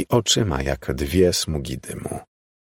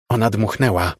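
lightly voiced ghostly girl calling; a hint of an eerie child calling out; 3 semitones higher than usual. A narrator's reading. The strange girl answers Miles in the snow, lightly calling. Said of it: "zawołała dziewczynka".

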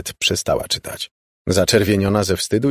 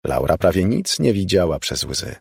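usual speaking voice

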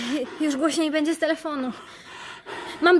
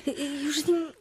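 soft, halting, low; hesitant false start; 4 semitones lower, 9 dB quieter than usual. Acted. Captive starts the prompted lie, hesitant false start. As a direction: fumble quietly for the excuse.

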